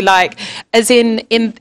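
ordinary delivery

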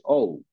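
O said as the English "ou" glide; English O sound. Shown as a demonstration. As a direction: model O the English way, as "ou". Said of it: "como se pronuncia en inglés". (O pronunciada como en inglés, "ou")

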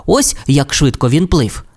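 ordinary delivery, nothing marked

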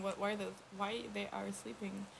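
ordinary talk, nothing marked

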